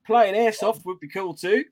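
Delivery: Aussie lilt going up at the ends; botched Australian with rising finish; bad Australian accent. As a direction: read it as a hammed-up Australian, lifting each ending. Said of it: "They always go up at the end".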